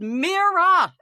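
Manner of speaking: high calling yell up to a window; shouting a name from the street. Retold or imitated. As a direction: call her name up to the third floor. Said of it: "I yelled".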